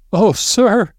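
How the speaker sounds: put-on very high old housekeeper's wail; whimpering moan. A narrator's reading. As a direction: moan it high, pleading to her employer. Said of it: "She was whimpering and wailing".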